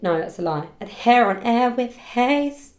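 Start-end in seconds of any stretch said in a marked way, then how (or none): none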